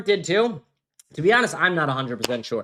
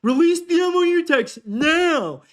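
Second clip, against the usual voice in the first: much higher, slow sneering mimicry; mocking impression; 11 semitones higher, 3 dB louder, slower than usual. Mocking a podcaster's demand about the MOU text, sneering. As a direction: parrot him in a high, slow, snotty voice. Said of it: "His hat is fully consuming".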